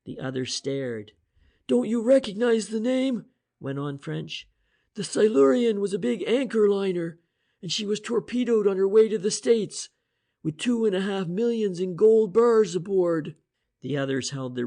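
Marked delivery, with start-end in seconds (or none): 1.7-13.3 higher, louder voiced inspector, eager reasoning; Inspector French voice. The inspector explaining the cipher to Price and Shane, eager. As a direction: lay out the theory with rising energy.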